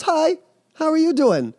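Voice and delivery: high, loud, cheery monster greeting; a sweet monster saying hello; friendly monster. Retold or imitated. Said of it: "the monster goes".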